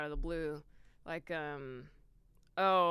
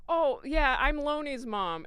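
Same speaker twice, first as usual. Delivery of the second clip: loud, higher, drawn-out mom line; sketch mom; 9 semitones higher, 9 dB louder, slower than usual. Retold or imitated. Actress replaying her mom role on air, loud and breezy. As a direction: announce yourself loudly as the mom. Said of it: "I come in as a mom".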